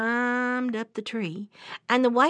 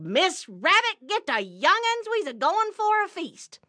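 excited announcement; 10 semitones higher than usual. Acted. Brer Rabbit tells his wife they're going for a feast, excited announcement. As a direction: announce it high and drawn to the whole family. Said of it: "he went home that night and he said".

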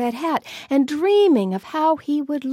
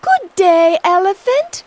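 louder, higher greeting; calling out; 4 semitones higher, 8 dB louder than usual. Acted. Fish calls out to the elephant on the bank. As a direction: call up to him from the water. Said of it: "called out".

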